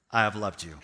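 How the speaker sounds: low, soft and solemn; tender declaration. Acted. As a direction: say it gently, as God's own assurance. God's declaration of love, quoted.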